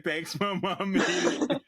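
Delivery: high, drawn-out quote through laughter; laughing imitation. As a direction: choke out the imitated line while cracking up. Cracking up retelling favourite joke.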